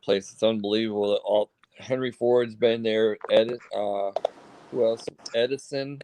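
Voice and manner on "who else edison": ordinary talk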